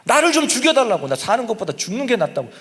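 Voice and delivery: preacher acting a furious Jonah demanding death; Jonah raging. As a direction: let Jonah explode at God. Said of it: "막 핏대를 세우고 막 항의를 하는 거예요".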